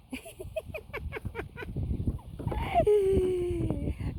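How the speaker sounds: wheezing laugh that breaks high; helpless laughter at the video. Real, not acted. Laughing helplessly at a woman who married herself. Cracking up again.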